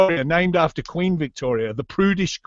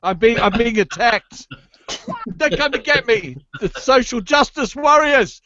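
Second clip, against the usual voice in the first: higher, hammed-up terror; mock fear; 6 semitones higher, 3 dB louder than usual. Australian guest joking warriors come for him, mock fear. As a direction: play a man cornered, tongue in cheek.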